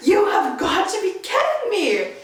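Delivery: high, loud, laughing yell of disbelief; "kidding" shouted high through a laugh; exasperated shout. Retold or imitated. At the shattered wine, a loud laughing yell. Wine bottle smashes in parking lot.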